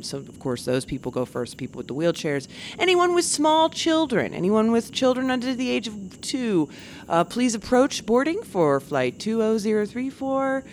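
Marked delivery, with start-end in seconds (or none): 2.8-10.6 high, sing-song boarding call; gate agent announcement. Retold or imitated. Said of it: "and they're like".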